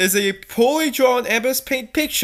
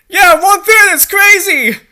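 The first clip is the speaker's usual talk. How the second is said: higher and louder hammed-up exclaim; mock excitement; 9 semitones higher, 9 dB louder than usual. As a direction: ham up the silly line. Reading absurd dialogue, mocking.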